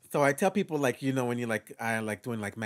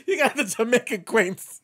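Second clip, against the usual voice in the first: loud, high Jamaican Queens shout-out; Jamaican Queens accent; 9 semitones higher, 8 dB louder than usual. Shown as a demonstration. Performer touring borough accents, exuberant Jamaican Queens.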